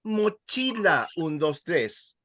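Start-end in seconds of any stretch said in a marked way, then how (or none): 0.0-1.1 slow "Mochila" with a raised "chi"; stress on CHI. Shown as a demonstration. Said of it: "you stress the second last syllable".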